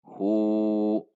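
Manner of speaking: slow measured Quran recitation with long held vowels; waqaf recitation. Shown as a demonstration. Teacher demonstrates stopping at hu, slow drawn-out recitation. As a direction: recite slowly, ending on a long sustained "hu". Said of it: "Sehingga huwa menjadi".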